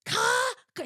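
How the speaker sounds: mock frantic mother calling her husband; flustered mother calling Carl from the other phone; imitating frantic mom. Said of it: "My mom would be on the other phone".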